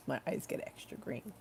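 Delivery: soft unvoiced whisper. Real, not acted. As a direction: breathe it out in a whisper. Whispered aside about crying.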